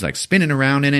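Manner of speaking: ordinary talk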